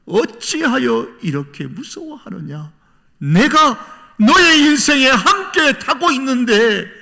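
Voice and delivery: slow, high, drawn-out lament; acted heartfelt anguish. Jesus aboard the believer's life, lamenting their fear, anguished. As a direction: draw out the aching question, swelling to a loud cry. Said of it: "안타까워하시면서 말씀하십니다".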